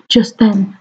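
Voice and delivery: plain reading voice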